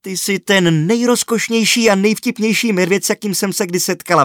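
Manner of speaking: put-on high, loud, fast gushing girl; girl crying out over a cute bear; excited cry. A narrator's reading. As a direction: squeal it out in adoration. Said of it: "vykřikla Sky".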